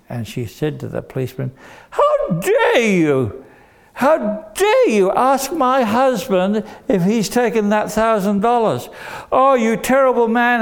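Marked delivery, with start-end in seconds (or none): 1.9-10.6 an outraged wife defending her husband; shrieking indignation, hammed up; indignant outrage. Indignant wife scolds policeman.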